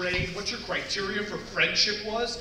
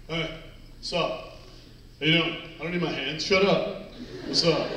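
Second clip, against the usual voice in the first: terse guy greeting; 4 semitones lower than usual. Retold or imitated. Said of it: "doing the guy chin wave".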